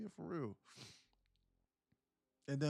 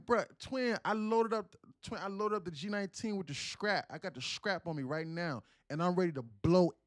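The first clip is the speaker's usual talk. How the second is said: loud, high Atlanta street slang voice; Atlanta lingo impression; 4 semitones higher, 9 dB louder than usual. Streamer mocking how people in Atlanta talk, loud and high Atlanta lingo. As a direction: ham up the Atlanta lingo, higher and louder. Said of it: "that's how they talk".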